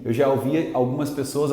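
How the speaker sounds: usual speaking voice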